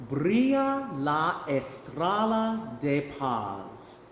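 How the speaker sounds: Spanish phrases spoken slowly, syllable by syllable; slow Spanish pronunciation model. Shown as a demonstration. Choir director saying Spanish phrases to repeat, slow pronunciation model. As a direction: stretch each Spanish syllable for learners to copy. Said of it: "I'll say it first and then you can repeat after me".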